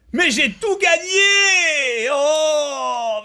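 crié, aigu et lent, étiré; cri de joie. Real, not acted. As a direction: hurler sa victoire, ravi. Gagne des tenues en jeu. (cri de joie excité)

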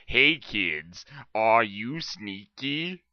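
ordinary delivery

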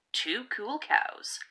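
ordinary delivery, nothing marked

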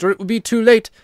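high, loud urging; urgent cry. A narrator's reading. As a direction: cry out in haste. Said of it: "cried the ghost".

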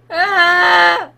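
loud drawn-out scream; loud "aah" of fright; startled long yell; scared scream. Real, not acted.

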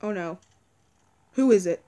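ordinary delivery

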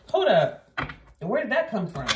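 high, loud startled double take; startled hold up. Retold or imitated. Leo shocked at their own words, high loud surprise. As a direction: catch yourself off guard, shocked. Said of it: "You're like".

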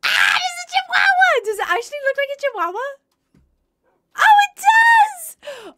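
high shouted squeal, stretched out at the end; thrilled shout at finding a chihuahua; excited shriek. Real, not acted. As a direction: shriek with delight as you spot him. Spotting the chihuahua dog.

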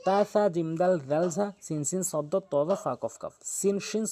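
normal talking voice

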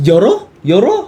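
high, loud, tempted questioning. Acted. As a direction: act out urging yourself to open it. 망설이다 여는 상황 재연. (들떠서 높게 되묻기)

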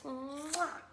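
drawn-out smacking mwah; kiss noise. Acted. Kiss fish kissing his friends, playful smack. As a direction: plant a big cartoon kiss.

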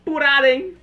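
loud, high laughing hype; hyped for the fight; excited anime fan. Real, not acted. Reação animada à treta. (fã de anime empolgado)

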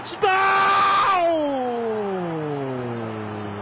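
long stretched touchdown scream; touchdown call. Real, not acted. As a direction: hold the touchdown cry out. TV narrator calls a touchdown.

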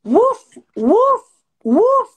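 high, loud barking woofs; yapping woof woof woof; dog barks. Pretend. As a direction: bark like a dog. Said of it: "I didn't say dog".

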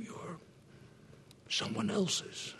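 normal talking voice

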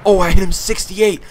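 high, loud outburst; excited yell. Real, not acted. Player who just hit an enemy, excited yell.

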